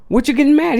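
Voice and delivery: high-pitched, retold annoyed question; retold annoyance. Staff question the upset parent, in a high mock-annoyed voice. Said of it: "They got mad".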